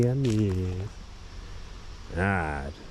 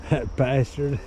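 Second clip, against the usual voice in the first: higher pitched, laughing it out; 7 semitones higher, 4 dB louder, slower than usual. Real, not acted. Drunk vlogger laughing about the beaver, higher and amused. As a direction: chuckle at the bastard.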